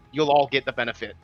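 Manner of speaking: usual speaking voice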